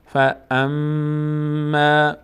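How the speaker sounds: very slow فاما with the doubled م hummed in the nose; nasal hold on فاما. Shown as a demonstration. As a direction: chant فاما, lingering on its م.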